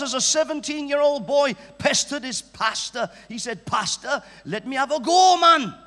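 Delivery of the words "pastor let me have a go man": put-on lightly Welsh teenage boy pleading; a hint of a Welsh lad begging; mild Welsh accent, eager. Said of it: "That's Welsh".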